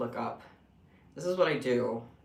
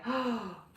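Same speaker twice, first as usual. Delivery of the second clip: surprised gasp; 8 semitones higher than usual. Real, not acted. Sudden idea.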